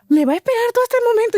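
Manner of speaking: giddy astonished question; amazed. Retold or imitated. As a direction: gush in disbelief like a smitten girl. Girl amazed he will wait.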